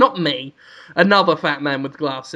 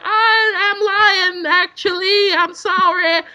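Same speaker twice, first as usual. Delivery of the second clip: put-on high, slow little girl confessing; girly high voice; 12 semitones higher, slower than usual. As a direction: squeak it out like a meek girl. Girl voiced in comic story.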